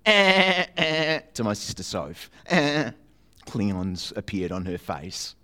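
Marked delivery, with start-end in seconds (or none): 0.0-1.2 very high, loud buzzing "Eh, eh"; ray gun noise. Retold or imitated. Boy zapping his sister in the car, loud toy noise. 2.5-2.9 higher, louder zap; one more toy zap; ray gun noise. Retold or imitated. Boy demonstrating the ray gun zap to the audience.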